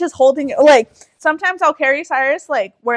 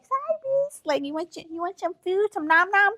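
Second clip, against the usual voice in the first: put-on baby talk; 6 semitones higher, 6 dB quieter than usual.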